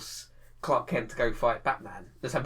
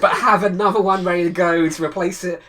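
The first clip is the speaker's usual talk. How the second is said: laughing; 6 semitones higher, 10 dB louder than usual. Real, not acted. Laughing while saying it.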